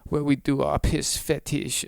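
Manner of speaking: mock the club kids in a hammed-up German accent; smug club-goer in a mock German accent. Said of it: "I don't know why I did Fr".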